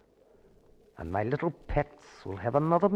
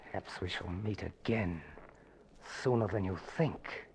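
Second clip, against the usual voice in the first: low, soft sinister promise; quiet menace; 7 semitones lower, 9 dB quieter than usual. Acted. Villain alone after the guest leaves, low quiet menace. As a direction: savour the threat under your breath.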